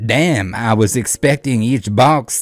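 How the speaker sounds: loud, slow, hammed-up French accent; put-on French accent. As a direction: exaggerate a fake French accent, drawing out the words. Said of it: "I don't know why he's turning French".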